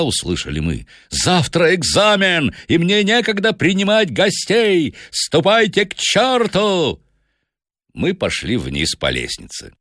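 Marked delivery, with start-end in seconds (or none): 1.1-2.5 high, slow, savage outburst; enraged student bellowing; bull-like roar. A narrator's reading. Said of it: "Макларен ревел, как бык". 2.7-7.0 high-pitched, ferocious dismissal; bull-like roar. A narrator's reading. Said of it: "Макларен ревел, как бык".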